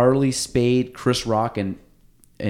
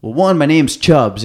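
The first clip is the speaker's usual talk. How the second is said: slow, loud and higher; mock punchline; 4 semitones higher, 8 dB louder, slower than usual. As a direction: lean into it, slow and emphatic. Relative's punchline, retold.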